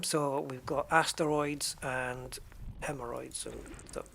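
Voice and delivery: normal talking voice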